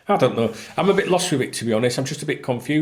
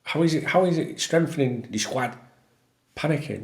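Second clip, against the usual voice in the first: ordinary delivery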